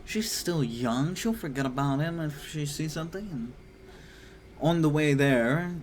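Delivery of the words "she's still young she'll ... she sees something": higher, lightly put-on father voice; a hint of a dad pitching a trip; lightly voiced dad. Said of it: "her father is just like".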